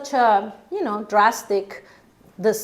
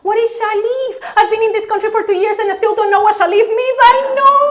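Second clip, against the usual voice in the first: much higher, fast, frantic self-talk; panicked inner voice; 11 semitones higher, 6 dB louder, much faster than usual. Retold or imitated. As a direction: spiral in comic panic.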